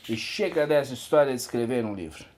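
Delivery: fast, run-together delivery; brisk rush through the whole sentence. Acted. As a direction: rattle the line off quickly. Repeats the line at quicker pace.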